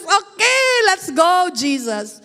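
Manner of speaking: high, energetic call; rally eagerly with Jesus; eager yes. Retold or imitated. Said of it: "I will just". (masiglang pagsang-ayon)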